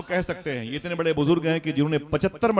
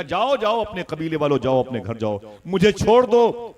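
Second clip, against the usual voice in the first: loud, high cry to leave her alone; grieving widow crying out; anguished sending away; 6 semitones higher, 8 dB louder than usual. Acted. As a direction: drive them out, slowing on छोड़ दो. Amina sends the clan away.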